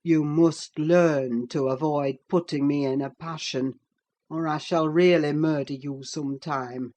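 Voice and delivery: low, held-back menace; assumed calmness. A narrator's reading. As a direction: force it calm with threat beneath. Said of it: "said with assumed calmness".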